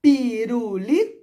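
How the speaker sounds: "Pirulito" slowly split into syllables, rising. Shown as a demonstration. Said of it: "ó".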